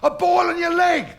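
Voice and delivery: loud, high scolding matron; shouting telling-off. Retold or imitated. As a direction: bark it at him like an outraged matron.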